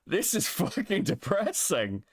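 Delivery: appalled but laughing. Real, not acted. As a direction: laugh helplessly through the words. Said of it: "I'm laughing".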